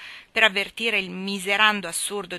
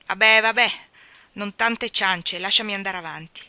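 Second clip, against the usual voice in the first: loud, impatient brush-off; dismissive; 7 dB louder than usual. A narrator's reading. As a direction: wave her off. Brother brushing her off.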